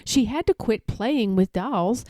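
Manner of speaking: usual speaking voice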